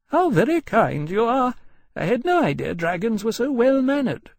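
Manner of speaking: high, emphatic, gracious mother's voice; charmed lady. Acted. Mother compliments the well-meaning dragon, charmed. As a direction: thank warmly in a high lady's voice.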